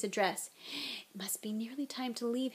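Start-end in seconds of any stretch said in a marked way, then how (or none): 1.1-2.5 hushed, whispery inner plea; miserably. A narrator's reading. Harry privately wishing detention were over, miserably. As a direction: think it softly and wretchedly. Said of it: "Harry thought miserably".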